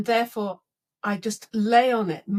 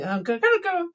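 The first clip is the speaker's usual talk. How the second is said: grumpy thought; 9 semitones higher, 4 dB louder, much faster than usual. Retold or imitated. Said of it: "I'm in a really bad mood 'cause I'm thinking".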